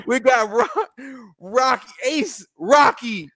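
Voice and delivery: rising hype, high and laughing; big hype announcement; excited hype intro. Real, not acted. Hyping up the guest.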